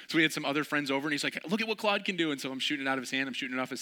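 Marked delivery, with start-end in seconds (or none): 1.5-2.3 high, excited bragging; proud showing off. Retold or imitated. Friend bragging to visitors, high and excited. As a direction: brag to the others.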